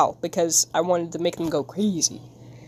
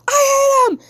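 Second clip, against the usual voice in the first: mock outburst in a very high squeal; squeaky mock-hatred; playful outburst; 3 dB louder than usual. Playful outburst about a character.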